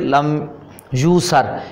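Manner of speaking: ordinary talk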